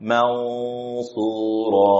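Mansura drawn out very slowly, nun thick and nasal; heavy mansura. Shown as a demonstration. Teacher models the thick nasal Mansura. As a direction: hum Mansura long and heavy. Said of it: "Contoh dia apa?".

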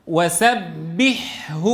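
very slow "وسبحه", long airy ḥ, then hu; syllable by syllable "وسبحه", ح and ه apart; clear unmerged ha. Shown as a demonstration. Teacher modelling for the class, slow and distinct. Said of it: "إذا أعطيت الحاء حقها من الرخاوة".

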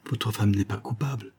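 ordinary delivery, nothing marked

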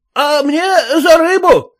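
high, complaining peasant voice; high peasant calling out; one-eyed peasant's cry. Acted. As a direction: whine up high for your fish money. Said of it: "кричал кривой мужик".

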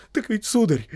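high, loud, still frightened start; quavering servant's appeal; trembling voice. Acted. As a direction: begin nervously, not over the fright. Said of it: "всё ещё дрожащим голосом".

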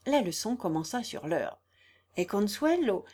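plain reading voice

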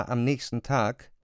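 normal talking voice

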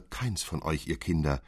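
usual speaking voice

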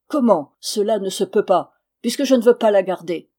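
loud, disbelieving protest on CELA NE SE PEUT PAS; indignant retort. A narrator's reading. Husband throws elder's words back, indignant.

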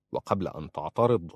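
ordinary delivery

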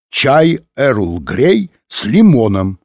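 loud, drawn-out triumphant answer; exclaiming. A narrator's reading. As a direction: proclaim it slowly and emphatically. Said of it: "воскликнул он".

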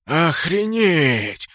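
slow, drawn-out stunned word; awed exclamation. A narrator's reading. Sprut learns who the orc is, stunned. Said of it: "прошептал Спрут".

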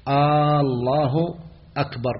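mock the muezzin who says "آه الله أكبر" with a long aah; drawn-out aah before Allah. Shown as a demonstration. Teacher mimicking a mistaken call to prayer, slow drawn aah. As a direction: stretch an "آه" in front of "الله أكبر", slow and questioning. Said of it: "بعضهم من الأذان يقول".

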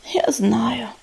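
quiet, breath-heavy sigh. A narrator's reading. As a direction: sigh the words out softly. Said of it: "вздохнула Пепелица". (тихо, со вздохом)

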